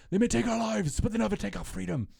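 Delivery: high, loud battle cry to the troops; William Wallace rallying cry. Retold or imitated. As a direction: rouse the army like a rebel leader before battle. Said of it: "So this is your William Wallace".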